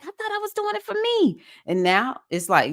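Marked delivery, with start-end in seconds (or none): 0.0-1.4 high, innocent put-on voice for the retold thought; mock naive self. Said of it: "And you're like".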